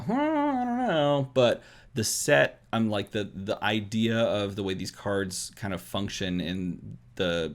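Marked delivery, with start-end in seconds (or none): 0.0-1.3 high, drawn-out skeptical hmm; doubtful hmm. Retold or imitated. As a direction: sound unconvinced. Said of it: "makes me go".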